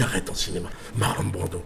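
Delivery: low, soft retold reproach; unimpressed mother. Mother telling her grown son to stop, unimpressed.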